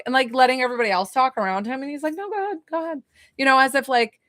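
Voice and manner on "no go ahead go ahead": softer, higher, a hint of New York; light New York accent. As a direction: wave others ahead, lightly accented. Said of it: "Was I doing the accent?".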